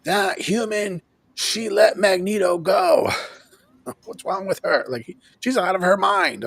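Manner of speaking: lightly voiced villain. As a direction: sneer it like a mildly voiced Sinister. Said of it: "He's like".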